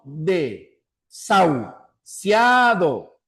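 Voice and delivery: "Desahuciado" drawn out slowly in separated syllables. Shown as a demonstration. Teacher counting syllables for students, "Desahuciado" slowly syllable by syllable. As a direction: break "Desahuciado" into long, distinct syllables. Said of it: "presente cuatro sílabas".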